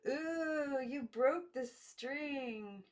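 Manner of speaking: put-on wide-eyed little girls; slow childlike dismay; awed girls. A narrator's reading. Girls react to broken string.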